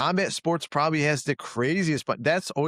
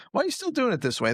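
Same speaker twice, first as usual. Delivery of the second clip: high, fast, baffled outsider asking; mock a newcomer's disbelief; incredulous question; 10 semitones higher, faster than usual. Coach imitating a member questioning old habits, high and fast. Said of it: "they go".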